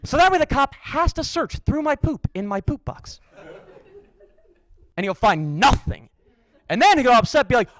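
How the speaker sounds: ordinary talk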